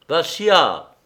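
sharp cry that falls at the end. A narrator's reading. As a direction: call out to a friend in alarm. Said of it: "cria Arcade".

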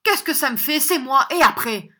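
loud, high-pitched brazen admission. A narrator's reading. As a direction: own up at full volume, daring him. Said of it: "répondit John en élevant la voix".

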